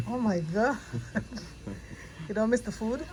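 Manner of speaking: ordinary talk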